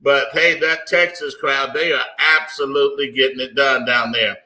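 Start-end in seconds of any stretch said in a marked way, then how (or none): none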